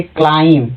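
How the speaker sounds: क्लाइम closed on an M sound; no B heard at the end of क्लाइम; silent B. Shown as a demonstration. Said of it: "बी silent है". (क्लाइम, ब बिना बोले)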